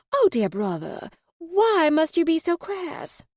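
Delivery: high, slow, mock-offended Southern belle accent. Luna mock-scolding her brother in a high Southern drawl. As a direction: play the wounded Southern belle, drawn out high. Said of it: "The accent continued".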